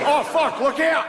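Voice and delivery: very high-pitched Australian alarm; mock-panicked Australian warning; high comic Australian yelp; Australian accent. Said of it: "an Australian guy in the office".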